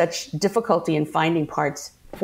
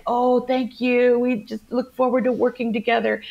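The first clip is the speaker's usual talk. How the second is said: higher, mock-gracious welcome; gushing welcome; 6 semitones higher than usual. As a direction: play the polite, welcoming host. Acted polite greeting to guest stars.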